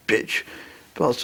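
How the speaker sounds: usual speaking voice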